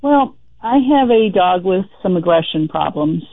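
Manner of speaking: ordinary talk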